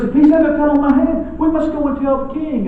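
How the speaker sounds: panicky Chicken Little. Acted. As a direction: squawk it out like a frightened little chicken. Chicken Little speaks to the fox.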